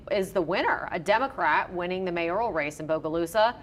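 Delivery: ordinary talk, nothing marked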